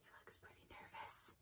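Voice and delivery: hushed nervous whisper. Pretend. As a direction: whisper it like a secret. Whispered aside to kids.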